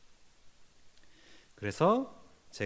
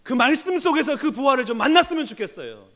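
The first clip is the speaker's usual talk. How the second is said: loud, driving sermon voice with hammered peaks; fervent preaching; 10 semitones higher, 8 dB louder than usual. Acted. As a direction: preach it hard to the congregation. Preaching at full force. (힘있게 외치는 설교)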